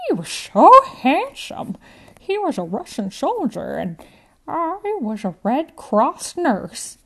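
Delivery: put-on high, loud, dreamy old woman voice; elderly lady lost in a romantic memory. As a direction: swoon over the handsome soldier. Grandma Jo tells romantic past.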